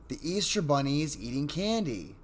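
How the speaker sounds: put-on little girl close to crying; serious, near tears. A narrator's reading. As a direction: say it as if about to cry. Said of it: "now in a more serious tone".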